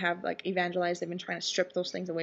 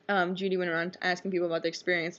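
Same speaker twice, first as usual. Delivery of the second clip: usual speaking voice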